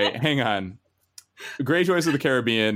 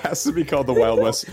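ordinary delivery

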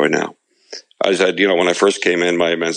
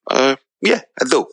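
put-on lightly done Jersey mob-boss accent, pitched up; hint of a sheepish Jersey tough guy; De Niro; 8 semitones higher than usual. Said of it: "You did that Jersey accent really well there".

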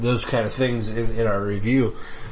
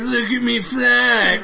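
goofy character voice; 12 semitones higher, 5 dB louder, slower than usual. Retold or imitated. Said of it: "He's like".

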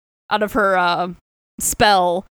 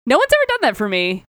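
higher-pitched, amazed retold plea; touched Soren; 7 semitones higher than usual. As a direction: act out being stunned by a rescue. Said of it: "And Soren's like".

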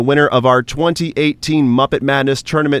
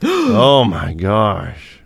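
mock shock; 4 semitones lower, much slower than usual. Mock shock at rigging joke.

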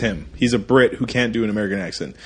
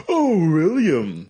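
higher, slow theatrical wheedle; over-the-top Doctor Smith; 5 semitones higher, slower than usual. Retold or imitated. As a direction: ham it up like a campy TV villain. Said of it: "he's very over the top".

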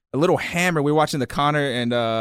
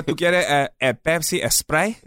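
higher, Spanish-accented offer of a drink; 4 semitones higher than usual. Shown as a demonstration. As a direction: pronounce "Sprite" with a Spanish accent. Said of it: "speak to people in Spanish".

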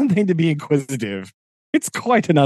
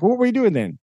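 higher, pushier question; Sam suspicious; 9 semitones higher, faster than usual. Retold or imitated. Sam questioning Gollum, higher suspicious demand. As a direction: press him, suspicious. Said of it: "He's like".

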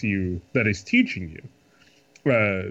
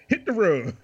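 careless parent voice; 8 semitones higher than usual. Retold or imitated. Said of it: "they're like".

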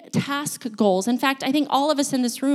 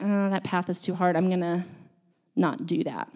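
giving up; 4 semitones lower, 5 dB quieter than usual. Acted. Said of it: "just kinda give up".

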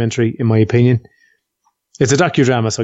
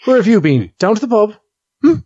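imitating Audrey; 8 semitones higher than usual. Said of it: "she'll do something like".

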